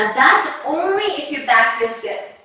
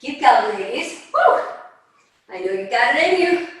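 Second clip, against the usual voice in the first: ordinary delivery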